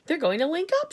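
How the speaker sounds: राष्ट्रपति उत्साह से चिल्लाते हुए; shouting. Acted. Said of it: "shouted the president".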